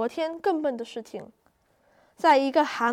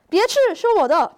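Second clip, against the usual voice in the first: sharp call; 5 semitones higher, 6 dB louder than usual. Acted. Turtle stops rabbit eating.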